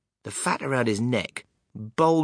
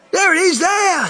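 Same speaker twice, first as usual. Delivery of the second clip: much higher, much louder, pointing it out; excited shout; 10 dB louder than usual. Acted. Someone in the crowd shouts, pointing at the ticket. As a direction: yell as you spot it. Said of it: "someone else shouted".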